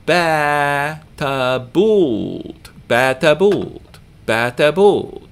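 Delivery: ba-ta-bult with held baa, quick ta, held buult; long, short, long syllables. Shown as a demonstration. Teacher demonstrating syllable lengths, long-short-long ba-ta-bult. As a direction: stretch the first and last of "ba-ta-bult", middle short. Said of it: "here we have long syllable, short syllable, long syllable".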